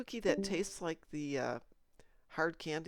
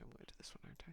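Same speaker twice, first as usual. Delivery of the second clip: whispering; 16 dB quieter, much faster than usual. Real, not acted. Muttering to herself at the screen.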